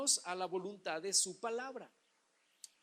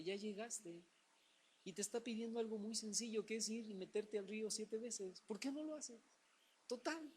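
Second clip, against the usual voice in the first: softer, gentle persuasion; servants coaxing; 7 dB quieter than usual. Acted. Servants gently urging Naaman to bathe, soft coaxing. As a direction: coax him softly like a patient servant. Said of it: "los criados le dicen".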